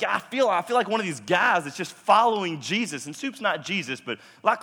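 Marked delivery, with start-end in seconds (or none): 0.0-3.1 excited. Retold or imitated. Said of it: "I was like".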